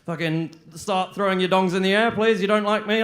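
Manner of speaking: usual speaking voice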